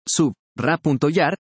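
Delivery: sub, ra punto yar split into separate beats sub, ra, yar; syllable by syllable. Shown as a demonstration. Teacher spelling out syllables for students, slow and separated. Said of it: "Cuidado con".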